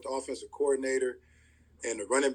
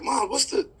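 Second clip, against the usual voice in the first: re-enacted exasperation, voice pitched high; frustrated son; 7 semitones higher than usual. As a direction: protest to mom in a high, frustrated voice. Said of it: "I get a little frustrated".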